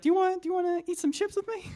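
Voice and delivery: homeless man's voice. Retold or imitated. Youth speaker imitates homeless man's greeting, high put-on voice. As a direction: pitch way up like the homeless guy greeting you. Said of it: "That's actually what he sounded like".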